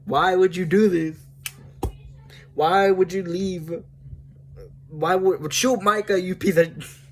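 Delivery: hammed-up angry pleading; acted outrage. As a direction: play up the disbelief at being betrayed. Acting out reaction to Dutch's betrayal.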